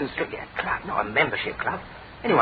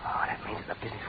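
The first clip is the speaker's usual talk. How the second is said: low soft whisper; 3 semitones lower, 8 dB quieter than usual. Real, not acted. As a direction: keep it barely audible. Hiding in yard, whispering.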